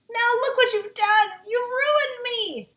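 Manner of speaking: high, loud, slow despairing wail; melting witch. A narrator's reading. Witch wails at Dorothy while melting, despairing. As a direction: howl out the loss as you melt.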